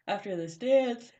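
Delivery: speaking mid-yawn. Real, not acted. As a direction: yawn through the words. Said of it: "I'm yawning".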